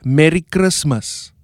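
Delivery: "Merry Christmas" in a Cape Town accent, R rolled; South African Cape Town pronunciation. Shown as a demonstration. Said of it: "in Cape Town, we say".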